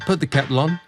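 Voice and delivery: plain reading voice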